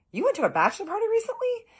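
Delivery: high, loud and fast, can't believe it; incredulous question. Retold or imitated. To the confused old man, startled and disbelieving. Incredulous at his bachelor party claim.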